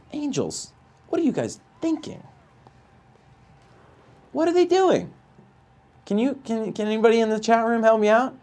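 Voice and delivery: incredulous plea. Real, not acted. Streamer pleading with chat, high incredulous voice. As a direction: beg for an explanation.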